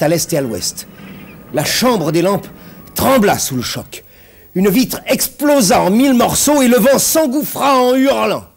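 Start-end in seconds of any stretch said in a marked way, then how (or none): 4.5-8.4 loud, high-pitched intense narration; dramatic climax. A narrator's reading. Narrator as a window bursts in the storm, intense and loud. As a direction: build to a howling peak of terror.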